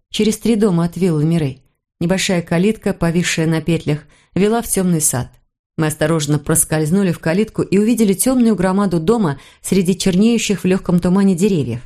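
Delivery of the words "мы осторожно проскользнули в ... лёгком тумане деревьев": ordinary delivery, nothing marked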